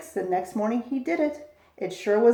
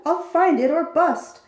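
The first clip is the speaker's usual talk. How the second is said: loud, determined cry; cried out; 5 semitones higher, 5 dB louder than usual. A narrator's reading. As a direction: vow it out loud. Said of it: "cried Horton".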